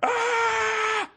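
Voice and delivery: mock crowd scream; crowd roar. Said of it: "People are going".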